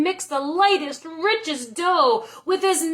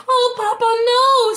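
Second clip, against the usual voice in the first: high, loud cry of protest; 10 semitones higher, 7 dB louder than usual. A narrator's reading. Serafina crying out to her papa. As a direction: plead loudly with her father. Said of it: "Serafina cried".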